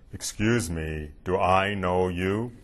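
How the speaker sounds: slow, cold pretend-not-to-know-you; haughty snub. Rabbi snubbing the father in a restaurant, slow and lofty.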